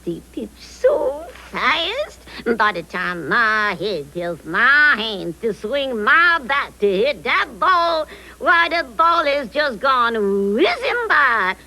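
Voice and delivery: drawn-out, woeful drawl; slow lament. Acted. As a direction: stretch the words out in dismay. Lamenting how fast pitchers throw.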